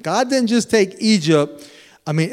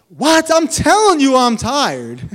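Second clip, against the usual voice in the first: much higher, louder indignant complaint; exasperated protest; 7 semitones higher, 5 dB louder than usual. Retold or imitated. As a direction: protest to God that you're worn out. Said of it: "I'm like".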